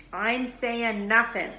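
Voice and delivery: a stubborn, deliberate refusal; flat refusal. Acted. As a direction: shut the topic down, word by word. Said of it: "said Hagrid flatly".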